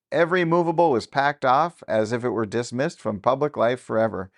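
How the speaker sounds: ordinary delivery, nothing marked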